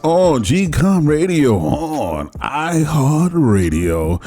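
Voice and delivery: high, slow show-opening announcement; radio host intro. Acted. Host opening his radio show for listeners, in a high drawn-out announcer voice. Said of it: "I'm your host".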